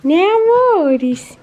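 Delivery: drawn-out cooing to the dogs; pet talk. Real, not acted. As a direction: stretch it out lovingly to the pets.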